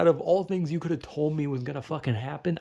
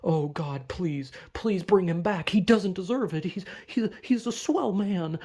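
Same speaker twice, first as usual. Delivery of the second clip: hammed-up begging, pitched higher; mock pleading prayer; 4 semitones higher than usual. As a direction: beg God with fake desperation. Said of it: "get on my knees and pray and be like".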